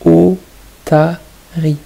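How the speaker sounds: L'éléphant said slowly with every syllable clear; drawn out for the children; teacher pronouncing a word slowly for the class; slow, careful word. Shown as a demonstration. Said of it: "retrouver la syllabe".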